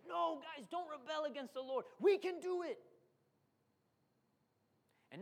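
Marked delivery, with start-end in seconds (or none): 0.1-2.8 bold encouragement. Retold or imitated. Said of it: "Joshua and Caleb said".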